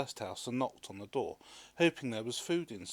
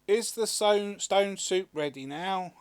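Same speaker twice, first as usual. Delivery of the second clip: loud, higher eager villagers; villagers' voice; 9 semitones higher, 9 dB louder, slower than usual. A narrator's reading. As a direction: crowd asking eagerly. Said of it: "asked the villagers".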